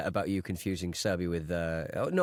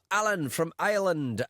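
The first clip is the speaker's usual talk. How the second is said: higher-pitched, accidental Scottish lilt; Scottish accent; 6 semitones higher than usual. Shown as a demonstration. Reading out a listener's name, in an unintended Scottish accent. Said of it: "said that in a Scottish accent".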